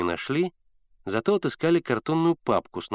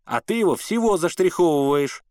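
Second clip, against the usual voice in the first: higher-pitched, raised-voice protest; mild narrated shout, pitched up; indignant shout; 6 semitones higher, 4 dB louder than usual. A narrator's reading. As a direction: cry out in exasperation. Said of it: "закричал Генка".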